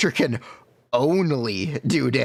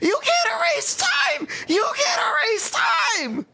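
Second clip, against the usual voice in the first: frantic high shouting. Retold or imitated. Meme rant climax.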